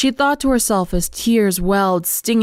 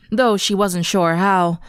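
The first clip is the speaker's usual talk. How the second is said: plain reading voice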